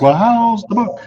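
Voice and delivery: a hint of a cheeky kid asking; curious kid. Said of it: "Now the kid's like".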